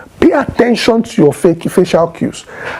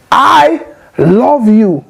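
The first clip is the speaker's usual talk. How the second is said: gentle loving tone; 4 semitones higher, much slower than usual. Shown as a demonstration. To a spouse, a slow, tender I love you. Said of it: "it's not the same thing as".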